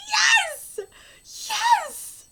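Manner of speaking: high, exultant yeses; thrilled cheer. Real, not acted. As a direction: cheer as if you just won. Cheering the requested reward.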